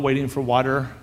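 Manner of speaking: normal talking voice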